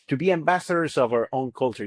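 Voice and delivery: ordinary talk, nothing marked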